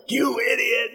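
hammed-up angry yelp; mock yelling. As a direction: act out snapping at someone in a squeezed high voice. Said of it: "we start yelling at people".

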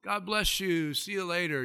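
high, breezy blessing, fading off; mock pious brush-off. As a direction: wave them off with cheap words. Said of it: "all you do is just".

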